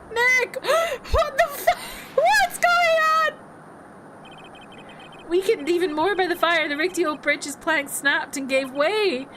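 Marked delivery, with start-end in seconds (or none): 0.1-3.3 much higher, slow stunned outcry; can't believe what just happened; high, slowed shocked outburst; shocked disbelief. Real, not acted. Player shocked by game event.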